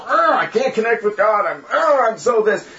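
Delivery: high-pitched bellyaching; mock whining. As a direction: grumble with exaggerated frustration. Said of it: "whine and bellyache".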